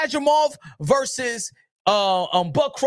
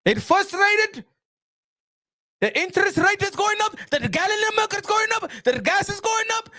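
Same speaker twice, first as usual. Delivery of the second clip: mock Turki rant; 6 semitones higher than usual. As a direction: play the offended Saudi promoter lecturing Americans. Impersonating Turki as promoter.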